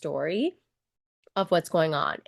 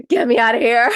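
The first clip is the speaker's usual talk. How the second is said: much louder, higher plea; mock desperate cry; 4 semitones higher, 10 dB louder than usual. A nursing mom hiding in a closet, mock desperate cry. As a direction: shout it like a trapped mom. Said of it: "And I'm just like".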